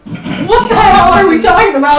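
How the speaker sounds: high, loud outburst of protest; loud disbelief. Real, not acted. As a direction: burst out, scandalized. Shocked at the lollipop remark.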